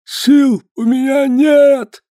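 high, slow, exhausted gasp; breathless gasp. Acted. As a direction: gasp it out, worn out and short of breath. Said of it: "задыхаясь, выговорил он".

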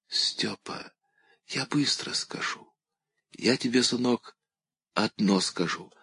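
breathless whisper, hurried; faint urgent whisper. A narrator's reading. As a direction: push the words out on last breath. Dying woman whispers urgently.

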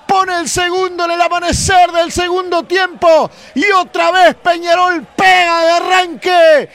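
high, loud, excited goal call; breakneck goal commentary. Real, not acted. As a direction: keep the goal euphoria going at full voice. Goal commentary after the score. (relato de gol a los gritos)

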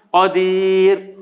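"قدير" drawn out slowly, thin clear r; light final r. Shown as a demonstration. Teacher giving an example word, slow "قدير" with light r. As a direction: hold the long i, end "قدير" on a light r. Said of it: "ينفع".

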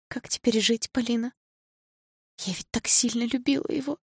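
high, fragile lament with pauses; tearful grief. A narrator's reading. Sasha confessing her love to Polina, tearful grief. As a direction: speak high and small, full of pain. Sasha grieving, full of pain.

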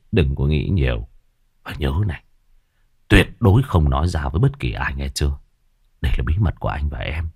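low, hushed male crook voice; conspiratorial gangster lover. Acted. Killer telling his lover to keep their secret, conspiratorial. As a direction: swear her to secrecy, low and firm.

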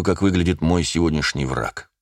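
plain reading voice

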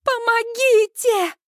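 high, drawn-out cry for help; panicked, stretched shriek; frantic scream. A narrator's reading. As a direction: wail desperately for help. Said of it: "истошно ору я".

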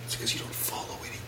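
very soft, near-whispered retort; whispered side remark; hushed aside. Real, not acted. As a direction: slip it in under the breath. Muttered aside under co-host's complaint.

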